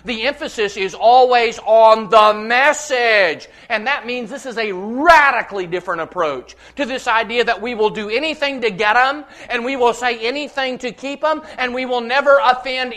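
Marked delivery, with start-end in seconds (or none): none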